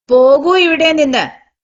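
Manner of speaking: loud, high command to leave; angry order. Acted. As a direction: throw her out with full force. Uncle orders her out.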